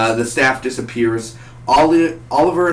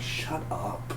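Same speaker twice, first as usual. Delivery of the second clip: much softer, deadpan dismissal; muttered annoyance; 3 semitones lower, 14 dB quieter than usual. Retold or imitated. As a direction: drop to a low-volume grumble. His fed-up reply to the yell.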